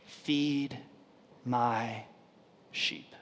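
Jesus gently restoring Peter, soft and tender; soft gentle command. Retold or imitated. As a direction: lay each word down quietly. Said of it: "Jesus says three times".

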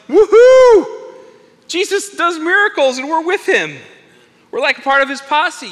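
high, loud whoop of triumph; giddy, high-pitched bragging; excited cheering. Acted. Disciples celebrate after the miracle, excited cheering.

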